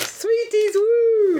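very high squeaky cheer; squealed excitement. Pretend. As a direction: shriek it out like a giddy kid.

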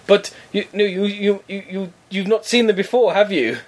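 flustered scammer. Retold or imitated. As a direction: stumble through a leading question as the scammer. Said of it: "And he says".

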